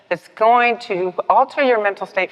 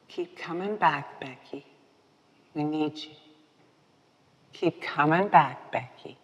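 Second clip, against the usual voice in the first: gentle welcome; 7 dB quieter than usual. Retold or imitated. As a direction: coax her back kindly. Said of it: "members welcoming her".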